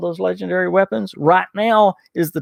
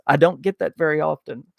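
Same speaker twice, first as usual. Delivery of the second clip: ordinary talk, nothing marked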